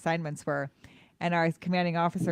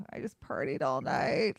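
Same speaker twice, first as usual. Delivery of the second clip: low, slow, softer groan of a reply; groggy complaint; 5 semitones lower, 5 dB quieter, slower than usual. Retold or imitated. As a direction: drag it out like someone just woken up. Retold hungover reply to mom's wake-up call.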